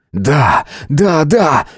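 ordinary delivery, nothing marked

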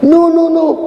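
very high, frantic denial; hammed-up scared Peter; Peter denying. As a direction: squeak out a panicked no. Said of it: "y le dicen a San Pedro".

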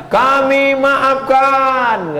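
high, slow mock solemn pardon; drawn-out, hammed-up forgiveness; family forgiving. The victim's family granting pardon, hammed-up and slow.

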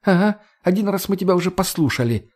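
smirking jibe in a woman's register; smirking Margo. A narrator's reading. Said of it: "ухмыльнулась Марго".

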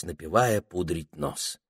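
usual speaking voice

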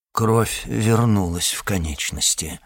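ordinary delivery

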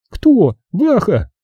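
put-on high girlish question. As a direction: ask it high and sharp. Zaza asks about brother.

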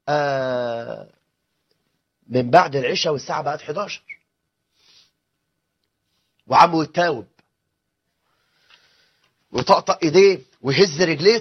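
ordinary talk

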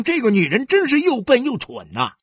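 high-pitched, exasperated rant; seething. A narrator's reading. Husband curses his wife inwardly, seething. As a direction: rail at her stupidity through gritted teeth. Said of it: "咬牙切齿".

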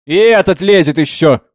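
loud, high, shrill complaint; shrill yell. A narrator's reading. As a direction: screech in annoyance at an intruder. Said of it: "визгливо закричала орфянка". (визгливый сердитый крик)

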